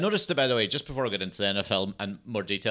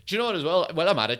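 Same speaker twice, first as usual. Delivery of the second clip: ordinary delivery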